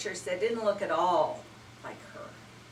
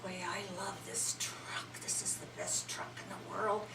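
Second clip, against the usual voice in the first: whispered inner thought; boy whispering love for his truck; thinking to himself; 9 dB quieter than usual. Acted. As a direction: whisper it like a secret to yourself. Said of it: "he was thinking".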